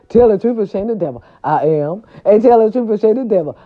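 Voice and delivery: ordinary talk, nothing marked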